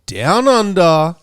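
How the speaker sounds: drawn-out, punchy hype-man opening; show announcer intro. Acted. Host opens the show for listeners, hyped announcer voice. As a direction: announce the show with big stretched words.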